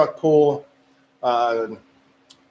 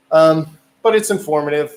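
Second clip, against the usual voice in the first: usual speaking voice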